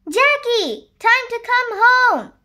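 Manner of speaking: high, loud calling-in voice; mother's shout. A narrator's reading. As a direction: call a child home from play. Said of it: "shouted Jackie's mama".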